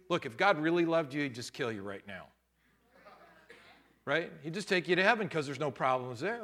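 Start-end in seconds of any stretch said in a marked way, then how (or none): 1.2-2.3 dry ironic drop. Acted. Ironic punchline.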